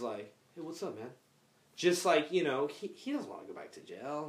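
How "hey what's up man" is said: subdued greeting. Retold or imitated. Mature brother's greeting.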